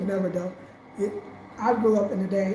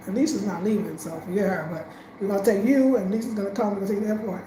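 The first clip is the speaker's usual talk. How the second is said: usual speaking voice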